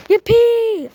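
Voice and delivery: high excited squeal. A narrator's reading. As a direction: squeal with glee.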